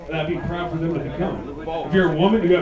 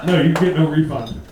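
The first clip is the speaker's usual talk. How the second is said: usual speaking voice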